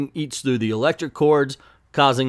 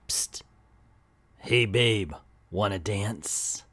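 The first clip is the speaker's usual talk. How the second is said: creepy come-on; 4 semitones lower, 6 dB quieter, slower than usual. Retold or imitated. Lurking attacker taunts Harpy.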